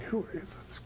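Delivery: breathless whisper of a bewildered wife. Retold or imitated. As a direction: whisper it, stunned. Wife baffled by changed husband.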